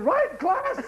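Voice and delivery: high, bright teacher calling the class; mock schoolmistress asking the class; prim teacher. Teacher rallying the class, prim and high.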